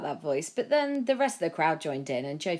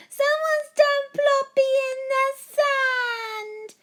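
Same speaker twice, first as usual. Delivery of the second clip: much higher, louder slow sing-song wail; wailing toddler; 9 dB louder, much slower than usual. A narrator's reading. Toddler keeps screaming.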